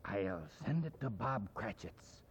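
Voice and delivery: a soft, quiet aside; whispered. Acted. Scrooge to himself, soft and quiet. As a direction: murmur it to yourself. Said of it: "whispered Scrooge".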